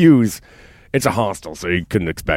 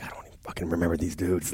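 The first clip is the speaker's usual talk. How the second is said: quiet aside; 2 semitones lower, 11 dB quieter than usual. Retold or imitated. Inner reaction retold quietly.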